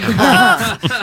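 bursting out laughing; a real burst of laughter. Real, not acted. Comedian cracks up at his own Greta joke, laughing.

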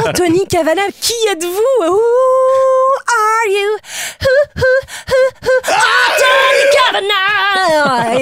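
high, drawn-out hammed-up announcer call; hammed-up game show host calling out the guest; mock grand introduction.

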